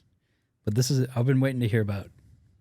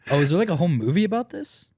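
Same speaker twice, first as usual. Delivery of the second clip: ordinary talk, nothing marked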